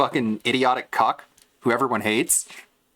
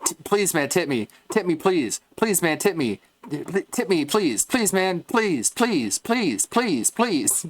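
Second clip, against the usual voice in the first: high, whiny pleading; hammed-up high begging, please after please; mock begging streamer; 6 semitones higher than usual. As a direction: beg for tips like a desperate gambler. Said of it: "Being like Boss Man Jack".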